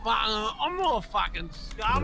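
put-on broad Aussie bogan accent; indignant Australian larrikin; silly Australian accent. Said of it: "you have a really silly accent".

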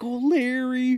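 high put-on lament; hammed-up wail for a friend; mock grief. Retelling him seeing Crystal lying there, mock grief.

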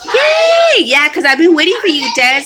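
high, loud cheer of delight; excited greeting. Real, not acted. To commenter Des who finally showed up, an excited high cheer. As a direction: squeal a happy yay at a long-awaited friend.

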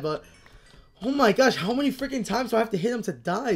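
ordinary delivery

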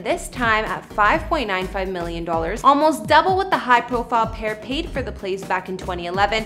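usual speaking voice

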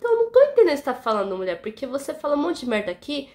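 fast, high and loud rant; losing patience, firing it all at her; heated, rapid mock tirade; exasperated outburst. Retold argument with coworker.

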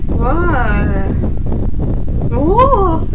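loud startled outburst; loud startled gasp. Real, not acted. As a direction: jump at the screen. Streamer startled by scene.

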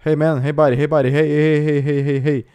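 loud, rapid-fire hailing; calling out. Real, not acted. Player hails another player over voice chat, insistent shouting. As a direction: shout repeatedly to get someone's attention.

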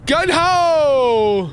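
long, high shout that falls away; loud, drawn-out battle cry; loud holler. Real, not acted. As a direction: holler it out high, stretching the last word. Yells out while playing the game.

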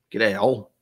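Aussie greeting at everyday volume; Australian accent. Acted. Streamer repeats the greeting to viewer, relaxed Aussie accent. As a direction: say it casually in a put-on Australian accent. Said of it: "slip into an Australian accent".

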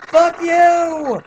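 real high-pitched, drawn-out shout; high, stretched battle yell. Real, not acted. Yells at the game opponent.

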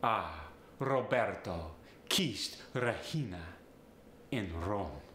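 Italian accent with tapped R in "Roberto"; Italian, tapped R on "Regina", dropping low on "Rome". Shown as a demonstration. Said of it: "there's often that tapped R".

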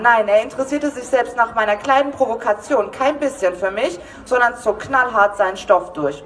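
ordinary delivery

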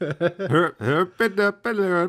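high, sing-song mock Swedish gibberish; fake Swedish. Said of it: "otherwise I'd do Swedish".

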